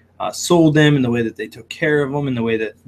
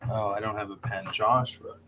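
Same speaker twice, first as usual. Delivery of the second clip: quiet mutter while writing; half-voiced note to self; muttering to himself; 3 semitones lower, 11 dB quieter than usual. Real, not acted. Noting a name down to himself.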